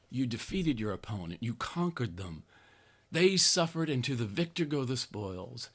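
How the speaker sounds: ordinary talk, nothing marked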